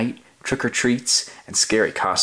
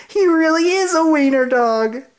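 high, louder taunting voice; mocking dogs; 6 dB louder than usual. Dogs mocking Oscar's costume, high loud taunt. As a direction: sneer it out like a teasing pup, up high. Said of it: "they cried".

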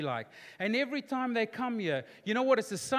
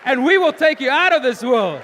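booming dad threat, high and loud; Bill Cosby; 6 semitones higher, 14 dB louder than usual. Retold or imitated. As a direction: burst out as the fed-up father. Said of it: "I do my famous Bill Cosby saying".

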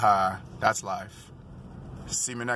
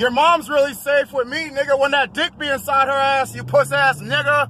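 higher, louder trash talk; mocking insult; 9 semitones higher, 5 dB louder than usual. Real, not acted. Trash-talking the driver who said safe, loud and high. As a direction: hurl the insult at him.

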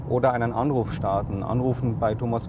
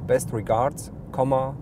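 dictating. Shown as a demonstration. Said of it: "you can freely dictate text".

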